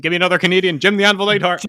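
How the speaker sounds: fast, loud and high rush of names; rattling off Canadian wrestlers with excitement; excited listing. Real, not acted. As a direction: pile on the names with glee.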